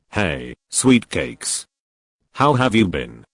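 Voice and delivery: slow, low, smug greeting; arrogant. Acted. Damien leering at Serena, arrogant drawl. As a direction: draw out sweet cakes lazily. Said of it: "Damien asked arrogantly".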